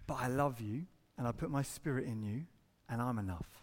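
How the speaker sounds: low, soft voice of comfort; tender reassurance. Acted. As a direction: drop down and reassure gently. God's reassurance after hard verdict, acted.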